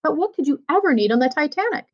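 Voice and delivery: ordinary delivery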